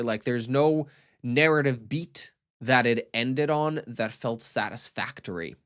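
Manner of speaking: ordinary talk, nothing marked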